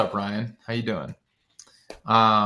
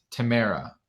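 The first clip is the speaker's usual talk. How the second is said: normal talking voice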